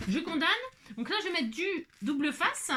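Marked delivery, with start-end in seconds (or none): 0.1-0.5 higher, punchy declaration; playful decisive call. Shown as a demonstration. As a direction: announce it with cheeky triumph. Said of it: "Allez, hop". (je condamne, lancé plus haut avec entrain)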